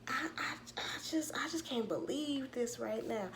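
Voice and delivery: high, stammering imitation of disbelief; mock disbelief. Daughter answering angry mama, mock flustered disbelief.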